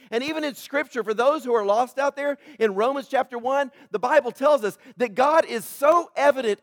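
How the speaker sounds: loud, rising pulpit preaching; fiery sermon climax; full-force preaching. Acted. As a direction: drive it home to the congregation, high and loud.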